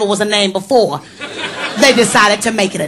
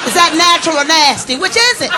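indignant sassy shout; 7 semitones higher than usual. Retold or imitated. Teller yelling at Rowena about her breast on screen, indignant. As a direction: yell back at her, full of attitude. Acted quarrel with Jamaican woman.

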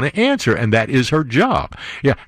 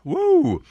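gleeful whoop. Real, not acted. Gleeful whoop after taunt.

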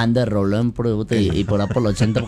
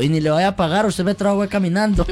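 conductor; 8 semitones higher than usual. Retold or imitated. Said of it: "le dice el conductor".

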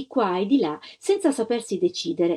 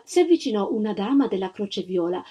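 plain reading voice

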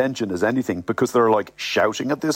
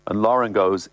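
ordinary delivery

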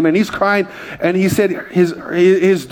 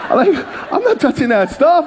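laughing; 6 semitones higher than usual. Real, not acted. Laughing while telling story.

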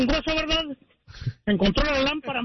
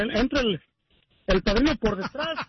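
ordinary delivery

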